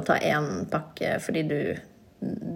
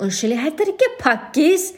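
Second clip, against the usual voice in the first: higher, slow correcting mock mother; imitating mom; 9 semitones higher than usual. Mom correcting the doctor on her name, higher slow mock voice. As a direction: set the record straight, slowly.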